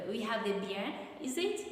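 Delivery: ordinary delivery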